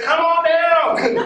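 high, slow, laughing "come on down"; game show call. Retold or imitated. Crowd shouting like a game show, high and drawn out. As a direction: belt it like a Price is Right host.

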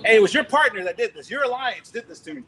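preacher jokingly scolding the airline, playful telling-off; mock scolding.

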